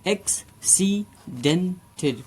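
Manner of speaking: "Accidented" बहुत धीरे: "x… सी… Dane… टेड"; "accidented" के हर सिलेबल पर रुकें; चार हिस्सों में. Shown as a demonstration. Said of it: "चार हिस्सों में हमने बोला". (शिक्षक की तरह "एक्सीडेंटेड" के चार अलग टुकड़े)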